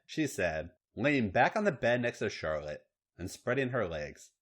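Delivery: plain reading voice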